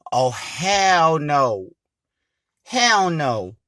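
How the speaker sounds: slow, loud, drawn-out refusal; slow and loud, scared refusal; spooked refusal. Retold or imitated. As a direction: stretch out the dread of it. Reacting to ghost story.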